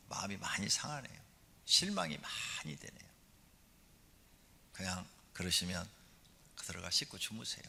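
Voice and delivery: soft, subdued reproach; gentle wife. Acted. Disappointed wife sending husband to wash and sleep, soft and gentle. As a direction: let the disappointment out softly, no scolding. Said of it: "이 여자가 부드럽게 얘기하면".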